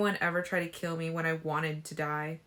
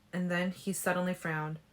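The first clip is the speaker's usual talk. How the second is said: usual speaking voice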